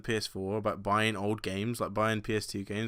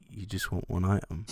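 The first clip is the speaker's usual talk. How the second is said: lower, leaning right into the phone; creepy close-up takeaway lady; 6 semitones lower than usual. Retold or imitated. Takeaway lady on the phone leaning in close, creepy and lower. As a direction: play the order-taker getting uncomfortably close to the mic. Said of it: "it's almost like she got really close".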